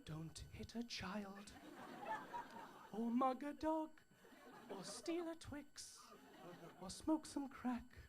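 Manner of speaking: slow, soft calming voice; soothing plea. Acted. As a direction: talk the crowd down gently. Calming tannoy-style plea.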